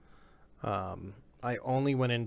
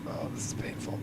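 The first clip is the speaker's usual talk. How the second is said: whispering; 4 dB quieter than usual. Real, not acted. Loading shaders again.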